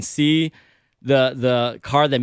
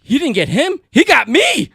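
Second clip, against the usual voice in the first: high, loud astonished outburst; mock-outraged cry of surprise; retold disbelief; 11 semitones higher, 5 dB louder, slower than usual. Driver telling his wife he got pulled over, disbelief.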